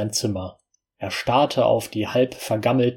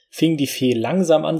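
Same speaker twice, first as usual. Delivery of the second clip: ordinary delivery, nothing marked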